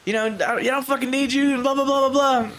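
lightly hammed-up angry quote; retold angry rant. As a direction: imitate the singer quitting the band. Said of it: "And he's like".

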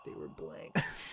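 drawn-out, very low, hushed reveal; slow whisper. Retold or imitated. Filmmaker repeating the twist to stunned friends, slow whisper. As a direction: whisper it slowly, letting it sink in.

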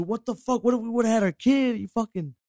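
high-pitched mock scolding; going off. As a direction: imitate a girlfriend going off on someone. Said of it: "my girl's going off on them too".

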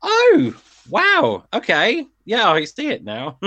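high, loud, dawning "oh wow okay"; surprised realisation. Retold or imitated. Fans in Lisbon realising the song is good, surprised. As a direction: act out suddenly getting it. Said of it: "we were like".

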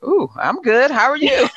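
high, lilting flirty reply, retold; playfully smitten. Her answer to the accented stranger, playfully smitten. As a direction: play up being pleasantly taken with him.

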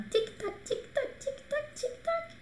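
high, soft clock ticking sound. Shown as a demonstration. Teacher mimics a ticking clock for students. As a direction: tick like a clock waiting for answers. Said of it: "teacher counts down answer time".

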